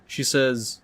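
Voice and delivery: ordinary delivery, nothing marked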